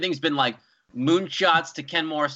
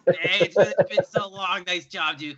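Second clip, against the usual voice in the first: Mookie greeting; 3 semitones higher, 9 dB louder than usual. Retold or imitated. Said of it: "Mookie Betts just coming up".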